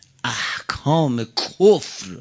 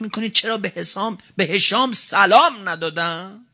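fiery preaching; 8 semitones higher than usual. Acted. Preacher rousing seminary students, impassioned sermon.

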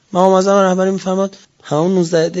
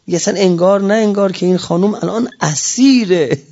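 ordinary talk

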